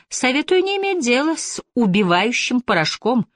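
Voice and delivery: a hint of an old Chinese elder; old man voice. A narrator's reading.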